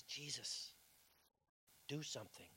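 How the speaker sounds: a mother pleading quietly at the cross; hushed plea. Acted. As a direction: beg him under your breath.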